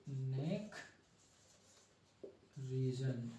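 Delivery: soft neck, long pause, soft reason; slow dictation. Real, not acted. Teacher writing the neck label for students, soft dictation. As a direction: say neck region quietly, one word at a time. Repeats term while writing it.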